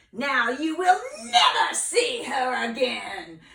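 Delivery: loud, high, slow witch threat; triumphant witch voice; gloating witch. Acted. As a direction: gloat over the blinded prince. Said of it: "said the wicked witch".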